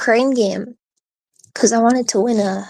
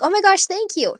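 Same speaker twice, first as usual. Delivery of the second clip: excited; 10 semitones higher than usual. Retold or imitated. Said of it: "I was like".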